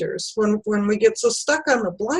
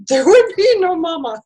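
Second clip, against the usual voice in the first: laughing; 7 semitones higher, 5 dB louder than usual. Real, not acted. Emotional mother laughing mid-line.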